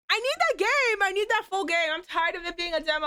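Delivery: high-pitched whiny begging; whiny pleading. Acted. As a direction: plead like a child wanting a toy. Begging the developers for game.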